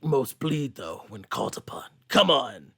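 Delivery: low, gruff, ending in a whisper; gruff Wolverine. Retold or imitated. Podcaster voicing Wolverine challenging Sarah, gruff then whispered. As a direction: threaten her, then whisper the dare. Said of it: "he's just like".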